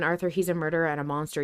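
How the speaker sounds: ordinary delivery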